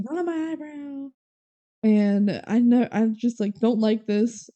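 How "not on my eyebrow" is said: mock freak-out. Megan freaking out, retold.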